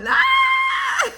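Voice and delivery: piercing high scream of labor, loud. Retold or imitated. Mother reenacts screaming while pushing out her baby. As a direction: let out a sudden shrill scream. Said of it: "Like, I yelled".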